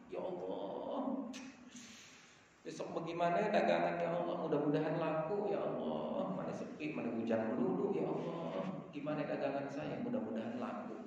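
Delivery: a trader's hushed, anxious prayer about tomorrow's sales; worried night prayer. Retold or imitated. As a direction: mutter a worried prayer to God late at night. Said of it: "bapak nih doa malam".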